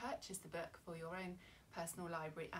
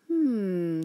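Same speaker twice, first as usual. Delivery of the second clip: loud wondering hum; thoughtful hmm; 21 dB louder than usual. Acted. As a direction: hum while pondering. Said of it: "Cyril wonders".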